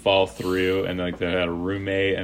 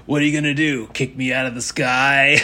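higher, cocky dare with a laugh breaking in; mocking defiance; 6 semitones higher, 4 dB louder than usual. Drunk Fred taunting the flight attendant, cocky and laughing. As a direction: taunt the crew like nothing can touch you. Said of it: "and he goes".

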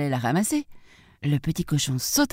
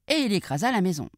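plain reading voice

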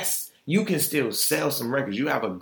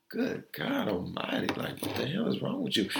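breathy whisper, much softer; whispered aside; 9 dB quieter than usual. Real, not acted. Aside to herself about Nicki, whispered. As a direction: hush it under your breath.

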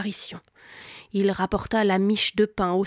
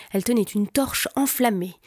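ordinary delivery

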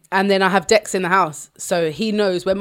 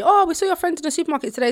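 high, quick gushing small talk; pitched-up, hurried mock chattiness; imitated chatty stranger; 10 semitones higher, faster than usual. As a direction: mimic an over-friendly stranger. Voicing strangers who approach her son.

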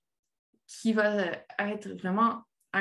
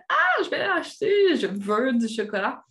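eager craving; 3 semitones higher, 4 dB louder than usual. Retold or imitated. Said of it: "qu'on se dit".

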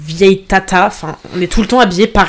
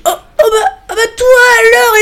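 put-on high, squealing drunk greeting; fake delighted squeal; 4 dB louder than usual. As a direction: gush like you found an old friend. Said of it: "je le vois et je dis".

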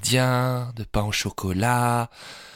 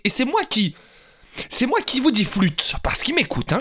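outraged; 8 semitones higher than usual. Acted. Outraged at colleagues' prank.

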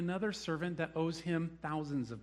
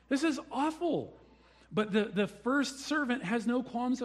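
outraged; 9 semitones higher, 4 dB louder than usual. Real, not acted. Preacher outraged at slavery.

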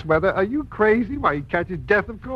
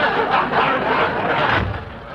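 hearty laugh; 9 semitones higher than usual. Acted. Ex-husband laughing at doorway joke.

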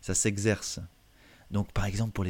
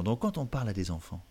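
normal talking voice

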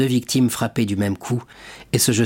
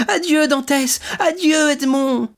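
much higher, calling after him; Mercédès crying out; 4 dB louder than usual. Acted. Mercédès criant adieu à son fiancé emmené. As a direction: call out a desperate farewell. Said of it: "s'écria Mercédès".

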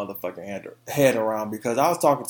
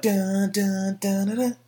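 dun dun sung, rising higher; mimicked beat; 6 semitones higher, slower than usual. Host mimics the intro of Just Fine. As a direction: hum the song's intro beat. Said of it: "it was like".

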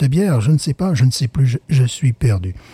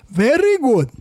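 high mock English very good; 8 semitones higher, slower than usual. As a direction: say it like a proud English taster.

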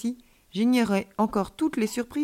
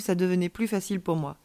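ordinary delivery

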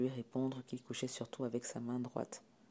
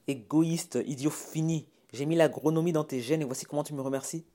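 raised, higher scolding voice; angry insults; 4 semitones higher, 5 dB louder than usual. Acted. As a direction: hurl reproaches at your child. Parent insults son. (invectives indignées)

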